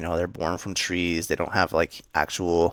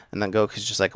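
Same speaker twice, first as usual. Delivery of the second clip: ordinary delivery, nothing marked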